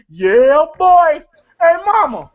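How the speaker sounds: loud, high hollered interjections; John Witherspoon. Retold or imitated. Film fan imitating the rib eater in the commercial, loud hollering. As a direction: holler short hype lines from the background of an ad. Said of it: "John Witherspoon eating a rib in the background going".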